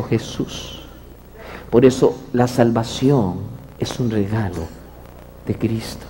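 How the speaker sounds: low, measured pastor preaching; calm sermon to a wayward young man; preacher. Acted. Pastor preaching calmly to Gillo.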